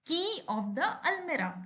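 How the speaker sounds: slow, deliberate reading of an example; slow example sentence. Shown as a demonstration. Teacher reading an example to students, slow and clear. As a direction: spell it out slowly for the class. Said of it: "use करते हैं of".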